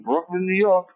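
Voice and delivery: ordinary delivery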